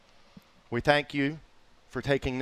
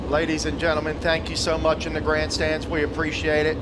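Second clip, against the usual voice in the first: loud, higher memorial call, slowing on key lines; PA tribute to a lost friend; solemn announcer; 4 semitones higher, 4 dB louder than usual. Acted. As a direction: lift the crowd for the send-off. Announcer thanks the grandstands.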